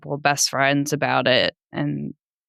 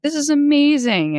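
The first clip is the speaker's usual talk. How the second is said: high, louder, gushing imitation of delight; giddy; 12 semitones higher, 4 dB louder than usual. Wife imitating her husband hearing about twins, giddy. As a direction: imitate someone thrilled, pitch way up. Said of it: "almost giddy and was like".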